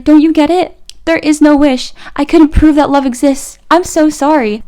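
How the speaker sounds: hammed-up teen movie heartbreak; mock distraught apology; distraught mermaid. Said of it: "Aquamarine was like".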